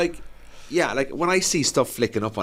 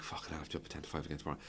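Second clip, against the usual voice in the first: weary mutter; 5 semitones lower, 16 dB quieter, much faster than usual. Retold or imitated. Tired after the gym.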